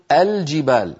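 الجبال begun with an open "a", "al-jibaal"; opening fatha. Shown as a demonstration. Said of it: "همزة وصل مفتوحة، فنقول".

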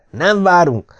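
high-pitched, insistent shout; narrated roar. A narrator's reading. Palmer demanding to see the commander, shouting. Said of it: "üvöltötte Palmer".

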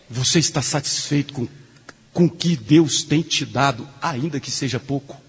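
usual speaking voice

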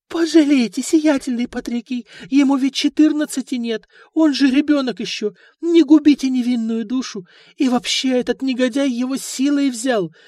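high, loud wailing plea of a poor mother; mother's tearful plea. A narrator's reading. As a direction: beg the noble through tears. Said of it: "горестными рыданиями".

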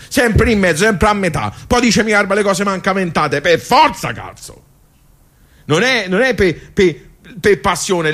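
high, rising lament of a forgotten generation; old man venting how his generation was always caught in the middle; impassioned outburst. Acted. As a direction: pour it all out, voice climbing high. Old man's emotional outburst monologue.

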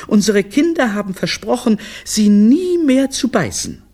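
high, sweet-talking lady on a call; high-pitched, placating mother; apologetic wife. Acted. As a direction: plead politely in a high voice. Wife's apologetic phone call.

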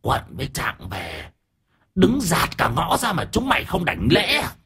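high, shrieky threat, held back in volume; shrill, menacing spirit voice; shrill possessed woman. A narrator's reading. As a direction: screech the command as an offended spirit. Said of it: "Bà cô Sim vẫn nói bằng giọng the thé".

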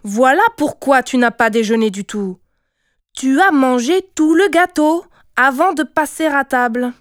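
loud scolding, rising in pitch; furious mother accusing her child; angry scolding. A narrator's reading. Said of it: "cria Kijivu en colère". (réprimande en colère)

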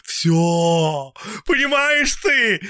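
high, loud, slow cry; shouting. A narrator's reading. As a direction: cry it out desperately. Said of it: "крикнул он".

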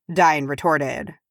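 ordinary delivery

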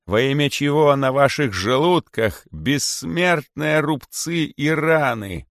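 high, slow indignant oration; snake king's rebuke. A narrator's reading. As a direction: scold the old serpents. (царь-питон гневно укоряет удавов)

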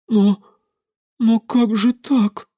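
high, halting, drawn-out question; bewildered murmur. A narrator's reading. Elder's wife, lost, questions Evgenia, bewildered. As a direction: stammer it out in bewilderment. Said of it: "пробормотала она".

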